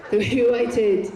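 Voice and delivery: normal talking voice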